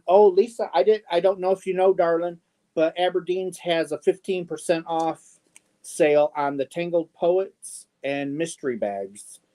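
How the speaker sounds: ordinary talk, nothing marked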